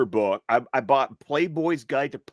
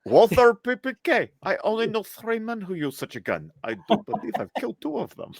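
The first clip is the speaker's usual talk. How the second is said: high-pitched put-on Russian accent; movie-villain Russian, pitched up; Robbie Coltrane Russian accent; 10 semitones higher than usual. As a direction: play a Russian gangster from a spy film. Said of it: "favorite way to do the Russian accent".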